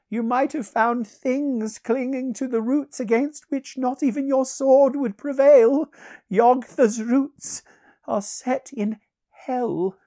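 high, loud voice, slowing into doom; ominous sorcerer. Acted. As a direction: warn darkly in a high, ringing voice.

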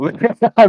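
bursts out laughing at own joke; big sudden laugh; loud laugh. Real, not acted.

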